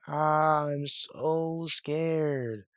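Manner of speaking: drawn-out, mock scared cry; half-assed scream. Unimpressed contestant humoring her scare partner, half-assed scream. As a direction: fake a scream with zero effort, very slowly. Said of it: "a half-assed scream like".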